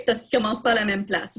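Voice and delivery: ordinary delivery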